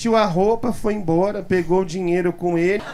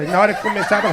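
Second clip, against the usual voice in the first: ordinary talk, nothing marked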